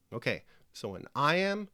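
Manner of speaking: ordinary talk, nothing marked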